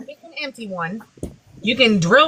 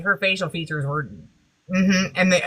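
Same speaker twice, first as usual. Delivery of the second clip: ordinary talk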